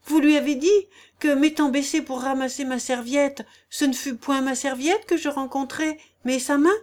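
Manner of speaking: high-pitched scandalized question; indignant disbelief. A narrator's reading. Wife indignant, questions the lord. (question outrée, voix haute)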